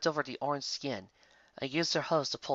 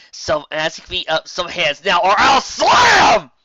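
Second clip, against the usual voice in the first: high, loud, frantic shouting; manic demanding rant; 7 semitones higher, 16 dB louder than usual. Acted. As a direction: demand it like a madwoman. Lyra lunges demanding hands.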